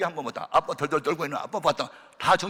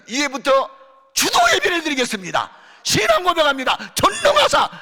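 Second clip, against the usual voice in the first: higher, louder liturgical calls building to a shout; leading worship; 10 semitones higher, 8 dB louder than usual. Retold or imitated. Evangelist leading worship for the family, building to a shout. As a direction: announce the service, building.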